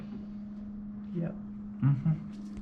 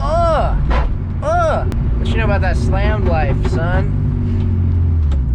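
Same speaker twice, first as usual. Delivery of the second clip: loud hype; 7 semitones higher, 9 dB louder, much slower than usual. Pretend. Hyped arrival in lowered car.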